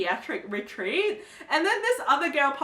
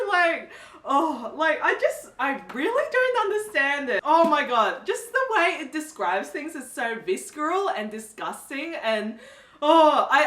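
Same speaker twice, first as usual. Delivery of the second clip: usual speaking voice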